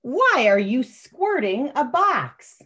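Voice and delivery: lightly voiced grown-up asking, drawn out; puzzled grown-up. Grown-up in the story questions the child, slow and puzzled. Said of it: "I'm gonna read the adult voice".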